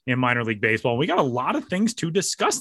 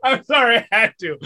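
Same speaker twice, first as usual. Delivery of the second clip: high, loud, giggly sorry; laughing apology; 9 semitones higher, 5 dB louder than usual. Real, not acted.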